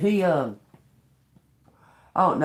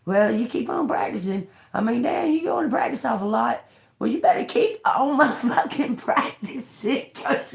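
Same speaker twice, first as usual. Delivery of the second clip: mock scornful voice; 7 semitones higher than usual. Mocking someone's hopeless practicing.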